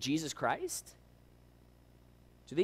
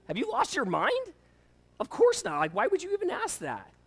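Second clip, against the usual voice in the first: mock indignation, pitched way up; outraged wife; 11 semitones higher, 4 dB louder than usual. Wife scolds husband for a silly question, high mock outrage. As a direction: act out an appalled wife, high and emphatic.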